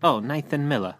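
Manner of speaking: lightly put-on British accent. Co-host echoing a UK supporter's name, mock British accent. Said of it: "We love the accent".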